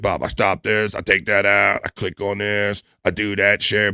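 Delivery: soft, lightly voiced impersonation; Bob impression. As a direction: narrate each step in a quiet mock voice. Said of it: "I'll do like".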